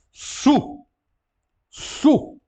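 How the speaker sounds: "Su" whispered high, u as in French; Greek su. Shown as a demonstration. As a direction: whisper the syllable "su" to model the vowel. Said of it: "Como podemos ver logo aqui". ("su" sussurrado, como u francês)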